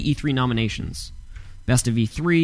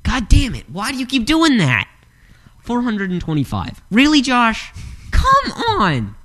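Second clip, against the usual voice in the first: exasperated yelling; 12 semitones higher than usual. Real, not acted. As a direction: shriek at him in disbelief, much higher. Frustrated reaction to wrong guess again.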